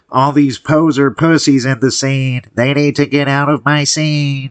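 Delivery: mock a whiny scene gatekeeper through a pinched nose; pinch-nose nerd voice. Vlogger mocking the Transylvanian's video persona, pinched nerd voice. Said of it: "the pinch nose kinda nerd accent".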